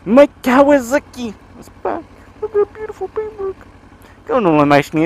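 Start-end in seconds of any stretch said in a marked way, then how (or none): 0.0-3.5 high, put-on doting voice. Rider cooing lovingly over his returned motorbike, high doting voice. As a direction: coo over the bike like a pet.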